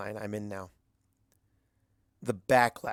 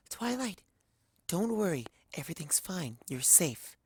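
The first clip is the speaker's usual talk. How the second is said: high, soft, soothing pony voice; gentle Fluttershy; 6 semitones higher, 5 dB quieter than usual. Acted. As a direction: coax a frightened friend softly back to calm. Fluttershy calms waking Twilight.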